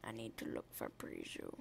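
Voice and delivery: very low, hushed rumble; a soft, deep put-on voice; really deep voice. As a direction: drop way down low and quiet.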